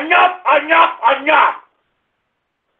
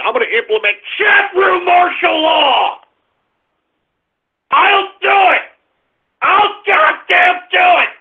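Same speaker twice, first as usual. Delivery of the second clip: furious shout; 4 semitones higher than usual. Real, not acted. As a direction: bark the threat at the chat. Threatening chat room.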